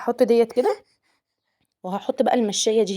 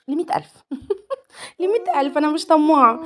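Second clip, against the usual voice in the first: giggling wish; 7 semitones higher than usual. Real, not acted.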